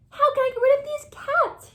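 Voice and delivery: high, loud frantic king; the troubled king calls his wise men, loud and high; king's desperate call. Acted. The king asks again in distress.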